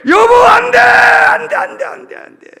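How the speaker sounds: very high, loud pleading cry; desperate shout. Acted. Husband screaming at his wife not to look back.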